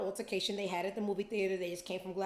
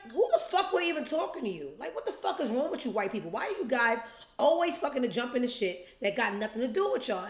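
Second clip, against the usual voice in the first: fast, louder, incredulous tirade with a laugh; rapid raised mock rant; heated comeback; 4 semitones higher, 4 dB louder, faster than usual. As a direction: fire it back at the meddlers. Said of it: "he's like".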